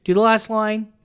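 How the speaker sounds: usual speaking voice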